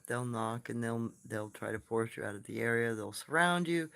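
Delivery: ordinary talk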